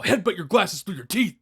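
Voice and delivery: high, slow mock threat; imitated pissed-off wrestler. Randy threatens a reporter in glasses, mock pissed. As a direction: stretch out the threat as a retold outburst. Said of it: "he was getting pissed".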